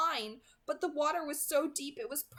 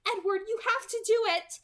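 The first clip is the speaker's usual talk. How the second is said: urgent; 11 semitones higher, 5 dB louder than usual. A narrator's reading. Alice urging Edward.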